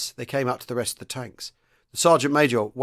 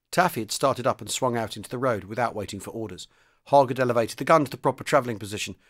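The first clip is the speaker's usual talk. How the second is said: ordinary delivery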